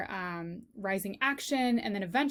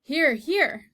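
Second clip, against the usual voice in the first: sharp call; 5 semitones higher, 7 dB louder than usual. Acted. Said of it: "said Cook".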